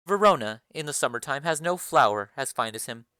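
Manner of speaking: low, commanding lady of the house; Lady Capulet. Acted. Lady Capulet calls the nurse for her daughter, low and commanding.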